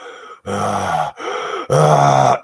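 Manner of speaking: long eerie breath in and out; imitated ghostly breathing. Recreating the ghost's breathing for viewers, long eerie breath. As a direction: breathe slow and heavy, like something right at the ear. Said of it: "I'm gonna imitate exactly what it sounded like".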